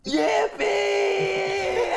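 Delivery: promposal. Acted. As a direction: bellow it like a metal prom invite. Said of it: "Death Metal Promposal voice for one page".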